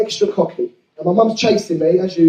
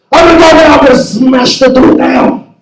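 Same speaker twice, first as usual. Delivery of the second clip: dad yelling; 6 semitones higher, 5 dB louder than usual. Retold or imitated. Dad threatening to smash the toilet door, yelling. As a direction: roar it through the door. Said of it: "In Turkish".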